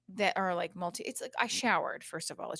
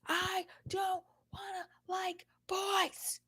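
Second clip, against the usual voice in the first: high, slow mock whine of a kid near tears; imitated upset child; 11 semitones higher, slower than usual. Mom retells daughter's response about boys, imitated upset child. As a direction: act out a child's tearful protest, pitched way up. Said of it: "And then she started crying".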